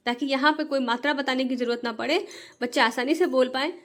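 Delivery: normal talking voice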